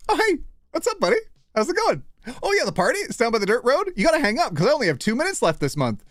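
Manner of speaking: high chirpy voice chatting into a phone; phone call chatter. Acted. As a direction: play a young caller gabbing on the line. Acts a millennial phone call.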